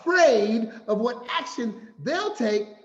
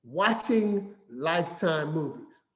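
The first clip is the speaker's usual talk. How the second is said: ordinary talk, nothing marked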